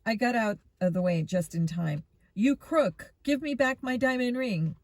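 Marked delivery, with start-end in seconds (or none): 2.3-4.8 put-on raised, higher voice with Russian accent; angry Russian. A narrator's reading. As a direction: demand it angrily in a Russian accent. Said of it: "the angry Russian yelled".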